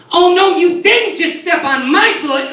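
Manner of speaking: put-on high, slow sassy girl voice; sassy city girl. Comic imitates the city girl whose foot he stepped on, high slow sass. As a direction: draw it out, mock outraged. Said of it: "she's like".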